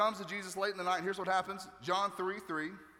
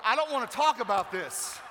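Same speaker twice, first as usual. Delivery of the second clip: loud, higher-pitched comic protest; mock flustered outburst; 4 semitones higher, 7 dB louder than usual. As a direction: protest as if embarrassed by the topic. Mock flustered over birth details.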